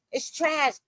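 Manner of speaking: breaking into a crying yell on "trash"; crying shout. Real, not acted. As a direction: sob it out, overwhelmed. Emotional rant ending.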